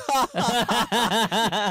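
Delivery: loud, high burst of laughter; a genuine high laugh at the joke; big laugh. Real, not acted. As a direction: let a real laugh burst out.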